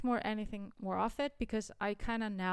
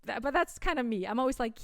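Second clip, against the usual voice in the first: ordinary talk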